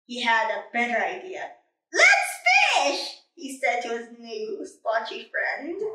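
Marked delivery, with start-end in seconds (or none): 1.9-3.2 eager cheer. Acted. Eager new idea.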